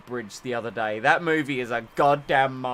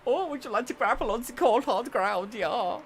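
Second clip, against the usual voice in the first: mock cartoon guard voice; 7 semitones higher, 4 dB quieter than usual.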